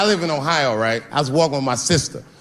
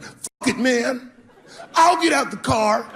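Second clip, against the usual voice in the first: put-on cocky teen; 8 semitones higher, slower than usual. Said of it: "He was like".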